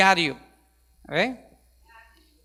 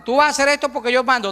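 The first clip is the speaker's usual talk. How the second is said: louder, higher, quick authoritarian command; bossy parent; 5 semitones higher, 5 dB louder, faster than usual. Retold or imitated. Pastor mocks a father demanding obedience, loud and quick. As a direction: bark it like a strict father.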